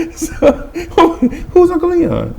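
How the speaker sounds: high voice through laughter; laughing. Real, not acted. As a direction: ask it while cracking up. Laughing at own story.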